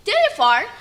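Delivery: ordinary delivery, nothing marked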